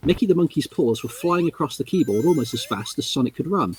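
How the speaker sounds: ordinary talk, nothing marked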